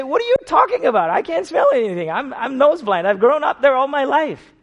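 loud, high, fast mock disbelief; hammed-up loud protest; incredulous retort. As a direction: toss it back fast, playfully amazed. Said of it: "And I say".

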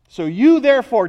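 "you" punched loud; stress on YOU. Shown as a demonstration. Preacher returning to the charge to Timothy, loud stress on "you". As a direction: hit "you" hard, carry it into "therefore". Repeats the verse's emphatic opening.

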